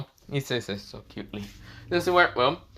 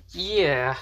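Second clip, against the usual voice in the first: higher voice with a little giggle; giggly; 7 semitones higher than usual. A narrator's reading. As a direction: own up with a playful giggle. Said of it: "just kinda smiles and giggles".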